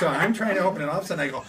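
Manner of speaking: ordinary delivery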